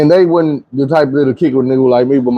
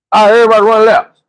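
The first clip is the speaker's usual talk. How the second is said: higher, louder team order; coach voice; 7 semitones higher, 4 dB louder than usual. Retold or imitated. Coach orders the team to run, higher and louder. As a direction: call out to the whole team. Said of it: "He like".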